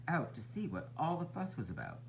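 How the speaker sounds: usual speaking voice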